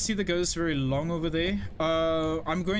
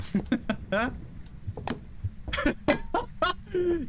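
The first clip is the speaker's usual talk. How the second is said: high cackling burst of laughter; laughing; 12 semitones higher than usual. Real, not acted. Streamer laughing at a spamming chatter, high helpless laughter. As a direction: crack up in a long, high laugh. Amused by spamming viewer in chat.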